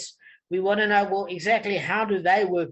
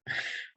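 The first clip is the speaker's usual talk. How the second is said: giggle; 11 dB quieter than usual. Real, not acted. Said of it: "I can't even keep a straight face".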